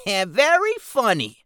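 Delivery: slow, high goblin grumble. Acted. Keswick grumbles back at his partner, slow and squeaky. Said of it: "Keswick grumbled".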